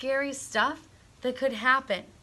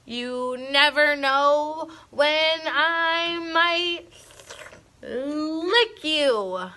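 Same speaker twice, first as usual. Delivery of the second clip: slow, louder mock-scary monster; trying to sound terrifying; 4 semitones higher, 4 dB louder, much slower than usual. A narrator's reading. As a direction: ham up the threat, not really scary. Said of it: "trying to sound terrifying".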